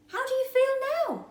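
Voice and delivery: eager Tiny. A narrator's reading. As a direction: ask him, bursting to know. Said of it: "Tiny asked".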